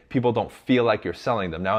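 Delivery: normal talking voice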